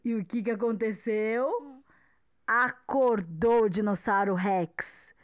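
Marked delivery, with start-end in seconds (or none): none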